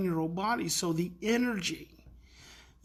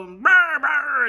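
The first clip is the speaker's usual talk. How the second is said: loud, higher mock fussing noise; imitated angry growling; 6 semitones higher, 9 dB louder, slower than usual. Imitating the mad boy fussing, angry mock growl. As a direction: imitate a kid's wordless angry outburst. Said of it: "he's mad".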